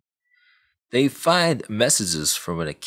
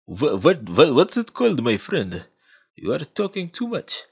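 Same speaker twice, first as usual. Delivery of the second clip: higher, put-on Russian accent; mock Russian accent; 7 semitones higher, 3 dB louder than usual. Russian contact replying to the bragging hacker, in a mock Russian accent. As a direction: play an unimpressed Russian contact.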